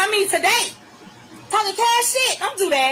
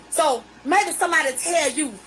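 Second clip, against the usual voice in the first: ordinary talk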